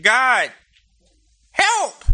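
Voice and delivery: high-pitched comic cry for help; hammed-up desperate appeal; mock panicked plea. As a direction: turn and beg, rising to a squeak on "help". Moses turns round begging God.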